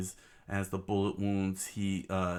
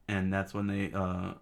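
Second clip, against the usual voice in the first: ordinary delivery, nothing marked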